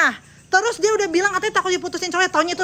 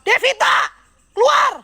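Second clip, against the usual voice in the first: higher, louder yell of keluar; shouted command; 6 semitones higher, 3 dB louder, slower than usual. Real, not acted. Vlogger yelling for the girl to come out. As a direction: call it hard at the house.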